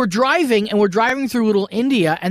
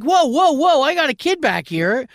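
retold alarm; 6 semitones higher than usual. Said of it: "And I'm like".

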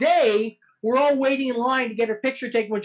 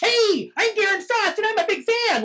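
high-pitched, eager fan introduction; imitated fan greeting; 10 semitones higher than usual. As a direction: gush at a celebrity in a raised pitch. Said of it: "like this".

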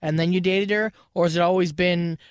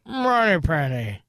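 put-on slow Sean Connery Scottish accent; 2 semitones lower, much slower than usual. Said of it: "That's my Sean Connery".

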